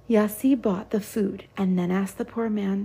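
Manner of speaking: ordinary delivery, nothing marked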